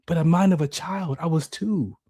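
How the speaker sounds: normal talking voice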